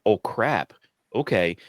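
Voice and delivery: ordinary talk, nothing marked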